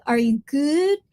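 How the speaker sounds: ordinary talk, nothing marked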